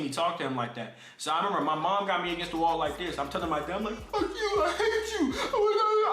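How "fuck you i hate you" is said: high, stretched-out tearful outburst; hammed-up wail of a crying teen; mock crying. Son telling off his dad, mock-crying outburst. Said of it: "I was crying".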